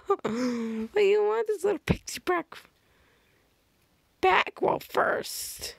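put-on very high, cutesy but creepy girl; sugary high squeal into an eerie whisper; [name]'s creepy adorable voice. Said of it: "sounds like a creepy, adorable voice".

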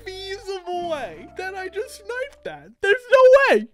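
high-pitched, drawn-out amazement; high, slow, astonished exclaiming; stunned disbelief. Real, not acted. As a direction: cry out slowly in ecstatic disbelief. Stunned he guessed it first try.

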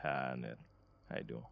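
low, soft mock interviewer greeting. Acting out the interviewer on the phone call, low and soft. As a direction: greet the candidate low and quiet. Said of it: "you better talk like this".

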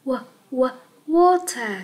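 "wa-, wa-, water" built from the first syllable; sounded out. Shown as a demonstration. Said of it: "chúng ta sẽ đọc là". (đánh vần "wa-, wa-, water")

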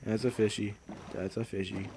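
soft low cooing. Pretend. Streamer cooing at a fish, softly. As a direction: coo at the fish.

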